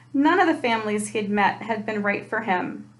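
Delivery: ordinary delivery, nothing marked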